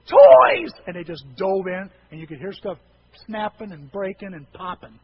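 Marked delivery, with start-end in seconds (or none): 0.1-0.7 high, loud gleeful yell; greedy shout. Retold or imitated. Cousin yelling at finding the hidden toys. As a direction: shout it like a kid finding treasure. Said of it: "David or Lonnie goes".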